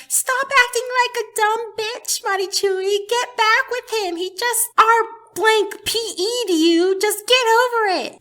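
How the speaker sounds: high-pitched mock nagging; exasperated viewers. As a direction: imitate impatient fans urging her, voice way up. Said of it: "many people ... were like".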